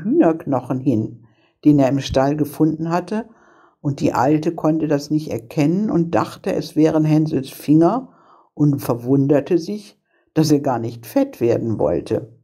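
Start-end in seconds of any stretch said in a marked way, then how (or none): none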